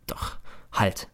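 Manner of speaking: breathy "Doch, halt" under the breath; soft whispered halt; hushed self-check. Acted. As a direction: stop yourself in a whisper. Narrator stops himself.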